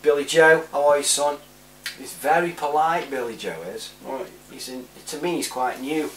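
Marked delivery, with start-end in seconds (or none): none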